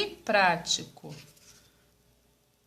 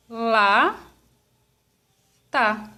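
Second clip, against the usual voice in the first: "lá, tá" drawn apart with a long pause between; syllable by syllable. Shown as a demonstration. Teacher dividing lata for pupils, syllable by syllable. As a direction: say "lá, tá" slowly as two separate beats. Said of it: "eu vou falar devagar".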